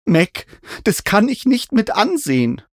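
high, agitated plea; distressed protest. A narrator's reading. Narrator cannot watch the climber fall, distressed. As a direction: cry out as if unable to watch.

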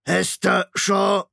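laut, langsam, höher, leiernd wie ein kaputtes Tonband; leiernde Maschinenstimme. A narrator's reading. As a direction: zieh den Namen wie ein defektes Band. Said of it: "Die Stimme leierte und knackte wie ein beschädigtes Tonband". (Shrike spricht Hester an, leiernd und knackend wie ein Tonband)

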